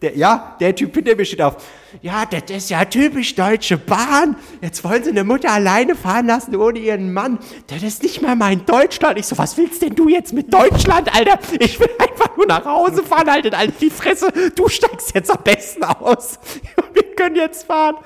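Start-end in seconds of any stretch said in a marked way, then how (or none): 2.0-9.2 hammed-up moral outrage; indignant passenger. Man behind stands up complaining. 9.4-17.9 much higher and louder, laughing through it; mock furious shout; exasperated outburst. His exasperated outburst at everyone.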